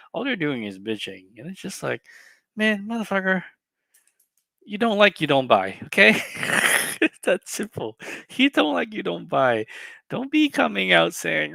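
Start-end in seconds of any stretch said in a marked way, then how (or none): 2.6-11.5 much higher, louder scolding; exasperated outburst. Real, not acted. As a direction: lecture them, fed up. Exasperated rant.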